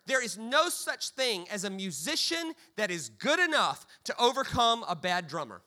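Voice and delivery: ordinary delivery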